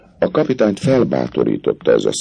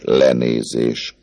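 plain reading voice

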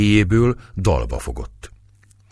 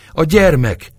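panting, much higher and louder cry; 10 semitones higher, 5 dB louder than usual. A narrator's reading. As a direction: gasp it out in rising panic. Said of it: "liegte Éva".

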